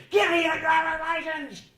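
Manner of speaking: loud, high-pitched demanding shout; yelling and screaming. Shown as a demonstration. Policeman screaming at a driver for the license, furious yell. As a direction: scream the order at full blast. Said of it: "start yelling and screaming".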